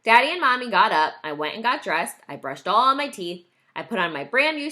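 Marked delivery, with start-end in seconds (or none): none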